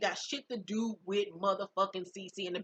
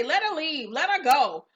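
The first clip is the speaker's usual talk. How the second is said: louder, higher retold command; acted impatient dismissal; 5 semitones higher, 6 dB louder than usual. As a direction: wave her away with raised voice.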